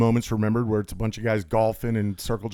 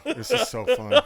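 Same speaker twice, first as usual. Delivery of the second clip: laughing; 12 semitones higher, 4 dB louder than usual. Real, not acted. Laughing through drinking fun.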